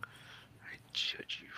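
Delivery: slow, breathy mock-creepy whisper; slow teasing whisper. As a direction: hiss it out slowly like a joke threat.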